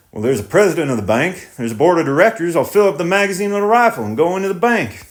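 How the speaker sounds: lightly voiced thoughtful farmer; tenant farmer voice. Tenant threatens.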